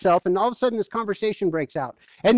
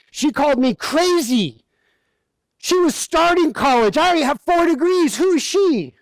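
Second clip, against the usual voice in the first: mock indignation; 6 semitones higher, 4 dB louder than usual.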